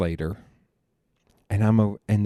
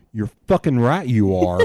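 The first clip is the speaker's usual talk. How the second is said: loud retold dad's retort; louder, emphatic quote of an intimidating dad; dad's forceful agreement; 2 semitones higher, 7 dB louder than usual. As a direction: imitate a dad firmly laying down the law. Acting out dad confronting the doctor.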